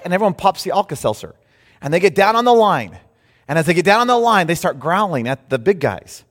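ordinary talk

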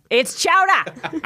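high, drawn-out Boston accent; hammed-up Boston "chowder", R dropped. Shown as a demonstration. Correcting friends in a broad mock Boston accent. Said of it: "that's a Boston accent".

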